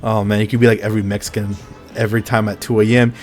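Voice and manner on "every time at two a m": usual speaking voice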